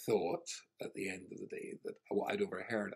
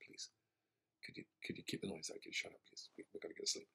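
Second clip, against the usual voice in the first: breathy near-whisper, softer and quick; tired hushed plea; 3 semitones lower, 11 dB quieter, faster than usual. Retold or imitated. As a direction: beg them quietly to shut up, worn out. Exhausted girl begs noisy neighbours.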